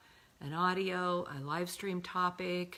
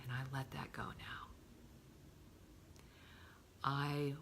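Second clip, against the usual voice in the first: soft affirmation; 3 semitones lower, 6 dB quieter than usual. Shown as a demonstration. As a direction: murmur it gently to yourself. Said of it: "the replacement could be".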